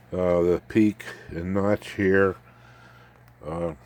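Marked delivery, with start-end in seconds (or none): none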